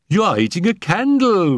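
high shrieking mock scream from the children; children shriek at the dazed Felicie. A narrator's reading. Children mocking Felicie, shrieking high and loud. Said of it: "we screamed".

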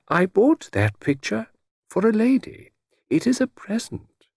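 high, measured gentleman's voice; gentlemanly Mister Satterthwaite, explaining. A narrator's reading. Satterthwaite explains the picture is for a lady, delicately. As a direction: explain delicately, pitched well up.

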